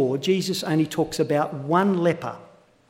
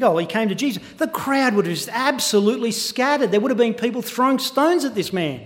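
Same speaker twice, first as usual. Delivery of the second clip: full-force preaching; 6 semitones higher than usual. Acted. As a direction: preach it out, stirring the congregation.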